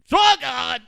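excited play-by-play call, rising fast; home run call. Retold or imitated. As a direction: call the swing like a live broadcaster. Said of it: "They didn't like my home run call".

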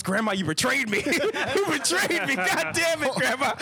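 boy accusing his grandmother, hammed up and high; mock betrayal. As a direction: wail at Grandma like a betrayed hero.

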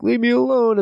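high, whiny little voice; whiny high plea. Retold or imitated. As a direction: beg to be left alone like a scared kid. Himself pleading in high voice.

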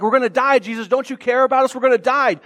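loud, high-pitched panicked cry; freaking out. Retold or imitated. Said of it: "sees the apostles freaking out".

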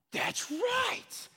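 high ringing yell of victory; triumphant shout. Real, not acted. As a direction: cry out in triumph. Triumphant exclamation.

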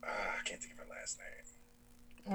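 whispering. Real, not acted. Whispered aside.

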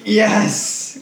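high, loud triumphant yelp; character voice. Acted. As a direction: squeak out a gleeful yes. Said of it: "Yeah, character voice".

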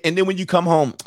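ordinary talk

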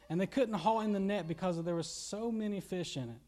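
ordinary talk, nothing marked

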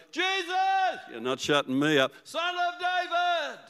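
high, stretched call to Jesus. Acted. Blind beggar calls to Jesus over the crowd, high and stretched. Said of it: "He shouted all the more".

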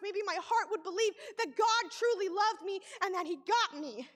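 ordinary talk

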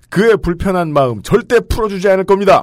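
high, loud, emphatic pledge; mock solemn vow. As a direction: swear it loudly, hammed-up resolve. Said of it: "라고 다짐을 해주셨어요".

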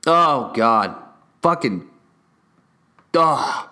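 usual speaking voice

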